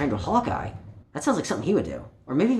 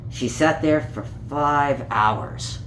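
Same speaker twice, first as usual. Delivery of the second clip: put-on reading a picture book aloud to children; gentle storytime read, page by page; story narration. A narrator's reading. Storyteller reading Peter Rabbit to young listeners, gently.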